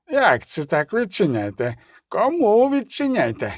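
put-on slow, querulous old man's questioning; lightly voiced aged quaver; trembling old gatekeeper. A narrator's reading. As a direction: draw each question out like a baffled old porter. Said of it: "по-старечому тремтячий".

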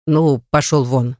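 low, mildly impatient dismissal; understated butler's impatience. A narrator's reading. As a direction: send him off without raising the voice. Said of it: "нетерпеливо перебил его Гаврила".

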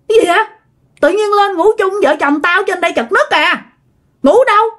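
indignant refusal. Retold or imitated. Dad refusing to share the couple's bed, indignant. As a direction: act out an irritated, disbelieving husband.

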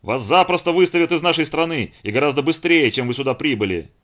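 loud, high, outraged shouting; full-voiced furious tirade; sudden yelling. A narrator's reading. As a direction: bellow threats in alarm. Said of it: "И вдруг он завопил".

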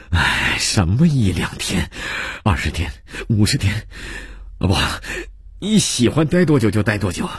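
warm protest rising in pitch; hearty welcome. Acted. Host urges his friend to stay as long as he likes, hearty welcome. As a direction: insist generously, brushing the modest request aside. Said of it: "这些热情的话".

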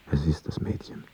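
soft, near-whispered realisation; hushed discovery. A narrator's reading. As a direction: drop to a whisper while spying. Narrator spying through a keyhole.

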